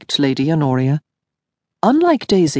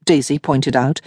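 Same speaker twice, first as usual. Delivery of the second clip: ordinary delivery, nothing marked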